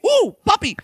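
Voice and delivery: high mock squeal of delight; delighted cooing. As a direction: coo high as if spotting a puppy. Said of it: "You look at me, you think".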